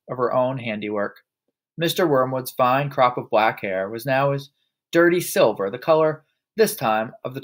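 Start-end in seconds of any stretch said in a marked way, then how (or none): none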